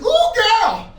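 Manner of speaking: high, loud, fast sassy gossip; gossiping woman in a nail salon. Retold or imitated. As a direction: spill the tea like a nail salon auntie. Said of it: "like a sassy woman in a nail salon".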